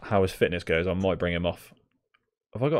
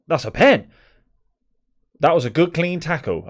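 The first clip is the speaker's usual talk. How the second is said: indignant shout; 9 semitones higher, 9 dB louder than usual. Real, not acted. Appeals for penalty in game.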